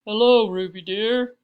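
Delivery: loud, rounded, stately greeting; big, cultured matron; deep, cultured voice. A narrator's reading. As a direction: greet her in a deep refined voice. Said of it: "in her deep, cultured voice".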